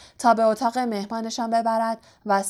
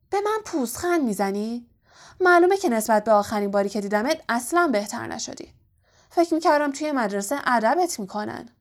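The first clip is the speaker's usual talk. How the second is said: plain reading voice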